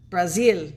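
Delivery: "Brazil" loud and firm, brah-ZEEL; showy Brazil. Shown as a demonstration. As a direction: demonstrate "Brazil" with a long ee at the end. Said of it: "You gotta say it like that".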